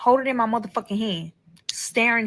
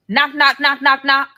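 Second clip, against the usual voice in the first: higher, louder knock knock; mock knocking; 6 semitones higher, 5 dB louder than usual. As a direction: rap it out like a knock at the door. Imitating knocking at door.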